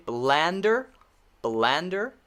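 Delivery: blander with an open short a, rising at the end. Shown as a demonstration. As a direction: stretch the a in blander, lifting the pitch. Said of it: "short A".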